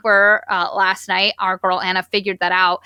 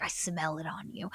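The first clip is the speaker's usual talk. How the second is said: an eerie, breathless whisper; creepy whisper; 4 semitones lower, 17 dB quieter than usual. Retold or imitated. The villain whispering menacingly to Anna, fully whispered. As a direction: hiss it close like a secret threat. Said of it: "he was like".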